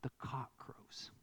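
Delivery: hushed, slow breathy whisper; dramatic whisper. Acted. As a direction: drop to a whisper for the rooster. Dramatic hush at climax.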